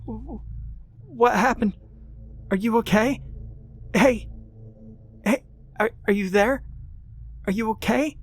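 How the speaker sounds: high, anxious calls into the dark; a scared girl pleading for an answer; frightened calling. A narrator's reading. Rebecca calls to the hurt man.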